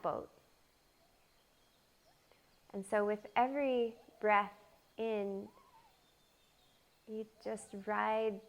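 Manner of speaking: unhurried meditation voice with long pauses; guided meditation. Real, not acted. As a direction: gently lead them into the breath, phrase by phrase. Leads a guided breath meditation.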